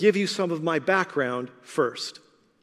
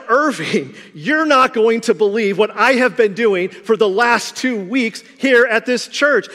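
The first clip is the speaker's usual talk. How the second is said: higher, louder preaching build; preaching fervor; 4 semitones higher, 6 dB louder than usual. Real, not acted. Preacher telling the congregation his excited reply, full preaching force. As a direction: rise to the big reveal.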